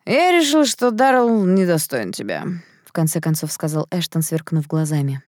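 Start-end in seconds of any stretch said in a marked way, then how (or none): 0.1-2.4 slow, swooping tipsy voice; stretched-out words of a tipsy suitor; drunken declaration. A narrator's reading. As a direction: declare it drunkenly, high start sliding down. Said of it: "с нетрезвыми спорить не стоит".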